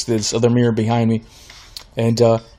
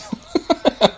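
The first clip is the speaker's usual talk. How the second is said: high laugh; 13 semitones higher, 5 dB quieter than usual. Real, not acted. Laughing at own haircut story.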